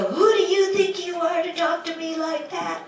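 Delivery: high, loud indignant comeback; indignant retort. Retold or imitated. Other person snapping back at someone yelling, high and indignant. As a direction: snap back, offended and loud. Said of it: "They go".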